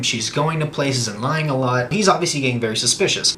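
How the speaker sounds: ordinary talk, nothing marked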